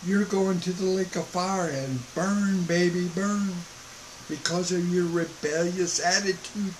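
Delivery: soft, even, almost soothing; calm, soft warning. Shown as a demonstration. Preacher calmly telling boomers they will burn, softly. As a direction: let the threat come out gently. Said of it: "is that calm?".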